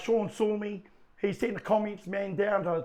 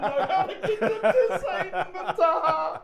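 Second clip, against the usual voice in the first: high, squeezed words through laughter; real laughter carrying the voice way up; laughing hard; 10 semitones higher than usual. Real, not acted. Fan telling hosts of his missed plug, helpless high laughter.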